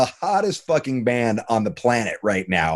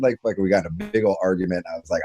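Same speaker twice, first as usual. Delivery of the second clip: ordinary talk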